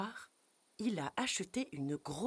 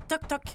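knocking sound; 10 semitones higher, 7 dB louder than usual. Acted.

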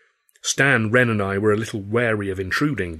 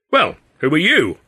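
loud, higher indignant throw-back; indignant retort; 7 semitones higher, 7 dB louder than usual. A narrator's reading. Hurley hits back at the stranger, loud and indignant. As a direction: fire the question straight back. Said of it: "Hurley said".

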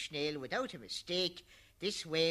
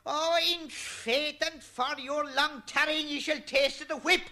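higher, loud, furious yelling; furious shout; 8 dB louder than usual. Acted. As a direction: bellow it at her while thrashing. Noah beats his wife.

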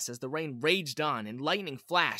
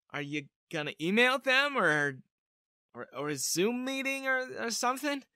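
awkward rambling; 7 semitones higher than usual. A narrator's reading. Awkwardly guessing spy contact methods.

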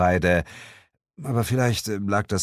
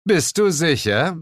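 teasing; 10 semitones higher, 5 dB louder than usual. A narrator's reading. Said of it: "zwinkerte ihr ... zu".

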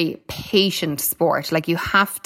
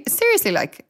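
surprised; 13 semitones higher than usual. Retold or imitated. Stunned by the puppy bet.

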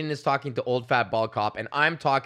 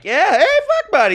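far higher, louder goofy buddy voice; hammed-up fake-chummy bro; 9 dB louder than usual. Braden schmoozing old cop, hammed-up chummy squeal. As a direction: overplay being friends with the cop. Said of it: "he's like".

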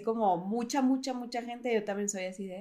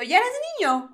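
put-on childlike question; 9 semitones higher, 3 dB louder than usual. Imitating little brother's question.

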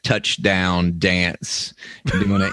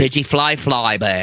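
comic shout of a made-up name; mock shouted dish name; 5 semitones higher than usual. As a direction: announce it like a silly menu item.

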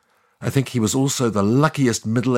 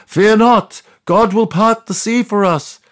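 much higher, louder rallying yell; yelling Moses; 10 semitones higher, 7 dB louder than usual. Retold or imitated. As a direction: rouse the crowd with faith. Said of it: "Moses yelled once more".

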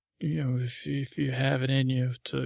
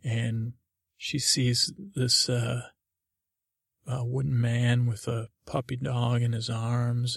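usual speaking voice

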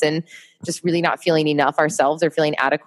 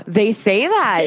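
shocked, stretching the words out; real shock; gasping surprise; 6 semitones higher than usual. Real, not acted. Shocked at hate comment.

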